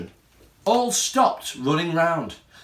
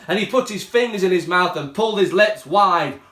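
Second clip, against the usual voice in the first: usual speaking voice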